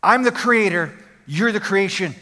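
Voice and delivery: ordinary talk, nothing marked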